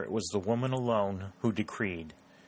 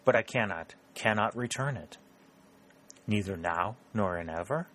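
usual speaking voice

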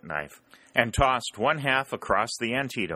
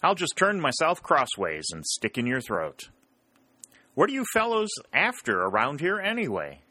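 lightly voiced soldier banter, a touch higher; joking soldier; 7 semitones higher than usual. Soldier joking back at the enemy picket, lightly voiced. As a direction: toss the joke back with a grin. Yankee jokes back, Rebel asks.

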